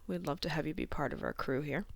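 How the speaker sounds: ordinary talk, nothing marked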